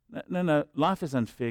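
normal talking voice

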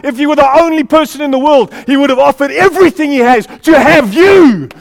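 loud, rising preacher's climax; a fervent sermon, building and pounding; preaching at full force. Acted. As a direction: preach it out to the whole room.

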